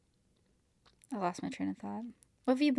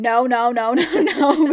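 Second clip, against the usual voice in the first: loud laughing no no no; 4 semitones higher, 12 dB louder, slower than usual. Retold or imitated. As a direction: shout no through laughter. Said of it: "I was going".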